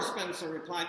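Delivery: ordinary delivery, nothing marked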